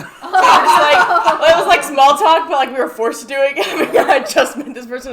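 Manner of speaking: loud, high, through real laughter; laughing retelling. Real, not acted. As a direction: crack up while telling an awkward story. Laughing over forced elevator small talk.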